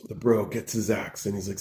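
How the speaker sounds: ordinary talk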